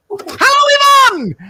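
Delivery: high, loud, long-held hello; psychic greeting. Acted. Medium calling to Yvonne, long high hello. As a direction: hold the hello high, then drop onto the name.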